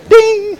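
very high bright bell sound, sung out; imitated heaven bell ding. Shown as a demonstration. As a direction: ring it out like a bell going off in heaven. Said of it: "watch, it goes like this".

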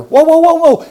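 high, loud whoa to stop them; sharp high halt; startled whoa. Real, not acted. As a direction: cut them off with a sudden high cry. Preacher halts the imagined objection.

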